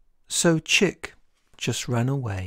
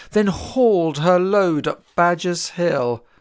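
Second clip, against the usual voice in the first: ordinary delivery, nothing marked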